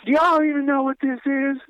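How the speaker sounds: mock the biker, high and disbelieving; incredulous biker. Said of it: "one of the dudes was finally just like".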